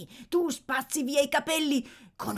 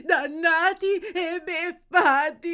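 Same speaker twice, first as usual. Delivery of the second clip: slow wail rising high; despairing wail; 4 semitones higher, 3 dB louder than usual. Acted. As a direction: repeat the curse as a louder, tearful cry. Same lament, building. (lamento straziante, più forte)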